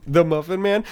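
squeaky half-sobbing cookie voice; gingerbread man voice. Retold or imitated. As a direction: whimper it like a frightened cookie. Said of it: "he sounds more like the gingerbread man".

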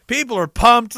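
ordinary talk, nothing marked

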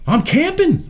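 high-pitched doctor on the phone; old doctor voice. Retold or imitated. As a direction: squeak it out like the gushing old doctor.

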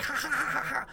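high mocking cackle; evil cackle. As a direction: cackle like a villain. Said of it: "then she just starts cackling".

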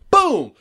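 loud gunshot boom; shouted boom. Pretend. Sound of gunshot acted.

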